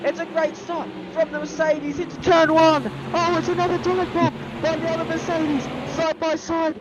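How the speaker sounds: high, loud race-start call; motorsport commentator. Acted. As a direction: call the lights out like live TV.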